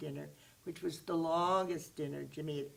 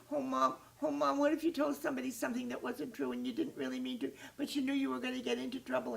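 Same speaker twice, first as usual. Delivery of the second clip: higher, rushed, worried plea; anxious pleading; 7 semitones higher, 4 dB louder, faster than usual. Acted. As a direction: tumble it out fast to Mom. Jimmy pleads to his mother.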